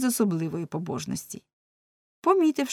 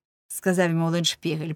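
plain reading voice

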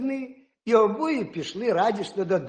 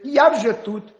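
calling out; 5 semitones higher, 7 dB louder than usual. Acted. Hedgehog calls out.

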